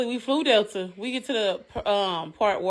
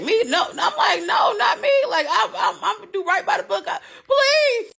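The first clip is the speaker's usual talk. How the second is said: mock pleading; 12 semitones higher, 4 dB louder than usual. Pleading with airport agent, retold.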